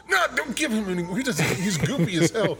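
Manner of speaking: 1 ex-wife objecting. Retold or imitated. Ex objecting to more morphine.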